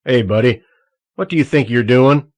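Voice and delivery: loud, gruff guard calling out; gruff shout. A narrator's reading. Guard shouting at Targeno on the catwalk, gruff and loud. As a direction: bark at the intruder from across the catwalk. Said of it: "The gruff voice broke his thoughts".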